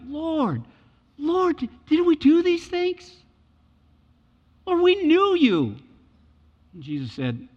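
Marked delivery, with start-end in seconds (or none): 0.1-5.8 high, slow, loud begging; pleading cry. Retold or imitated. False believers pleading with Jesus, high slow begging. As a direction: drag it out, desperate. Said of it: "the affection they will have".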